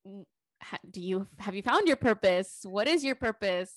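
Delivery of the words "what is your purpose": normal talking voice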